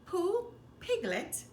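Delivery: plain reading voice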